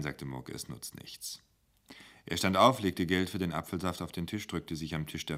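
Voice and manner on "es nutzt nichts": resigned murmur. Acted. Murke resigned, low.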